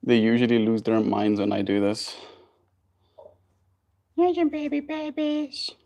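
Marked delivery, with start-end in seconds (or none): 4.2-5.7 high, soft, slow coo. Real, not acted. Cooing at baby birds.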